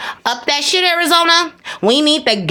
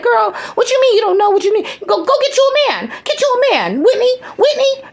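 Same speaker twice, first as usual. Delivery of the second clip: excited teasing to mama; 12 semitones higher than usual. Retold or imitated. Speaker teasing her mama about [name], high excited voice. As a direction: cheer her on like a hype girl. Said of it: "I was like".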